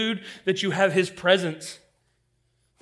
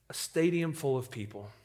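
ordinary delivery

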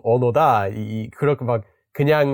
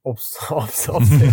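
laughing. Real, not acted. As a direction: laugh out the denial. Laughing denial. (웃으며 부정하기)

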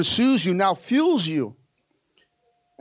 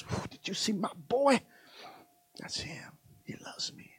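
softer, whispered proud boast; soft proud brag; 13 dB quieter than usual. Acted. God bragging to his angels about his boy, softly. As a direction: brag quietly, almost to yourself.

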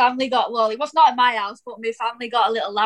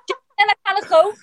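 a quick, laughing go at a Scouse accent, pitched high; 10 semitones higher, 4 dB louder, faster than usual. Shown as a demonstration. Dan tries his Scouse accent for the hosts, laughing. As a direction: rattle off the line in Scouse, giggling. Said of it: "let's hear it".

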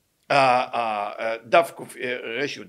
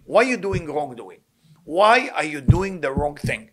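normal talking voice

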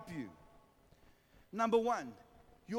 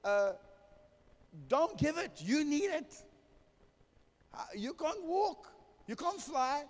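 high, coaxing tempter's voice; tempting Mammon voice; 8 semitones higher than usual. Retold or imitated. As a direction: whisper doubts like a sly tempter, pitched high. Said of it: "Mammon is standing next to me and saying to me".

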